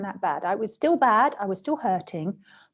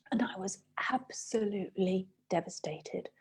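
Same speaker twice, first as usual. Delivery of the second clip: 1 devastated whisper; 8 dB quieter than usual. Real, not acted. Recalling a devastating loss.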